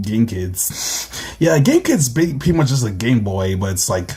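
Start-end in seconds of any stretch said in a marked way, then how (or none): none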